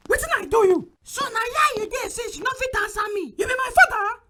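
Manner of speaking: loud, exasperated outburst; angry yelling. Acted. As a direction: shout it, fed up and furious.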